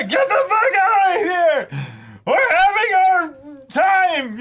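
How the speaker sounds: high falsetto mock yell, slowing at the end; shrieking newlyweds chasing off the parents; mock angry shout. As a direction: falsetto comic yell to get out. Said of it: "nonsensical parents being like".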